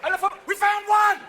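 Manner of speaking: high, loud alarm call; alarmed guard. Acted. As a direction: shout the sighting to the squad.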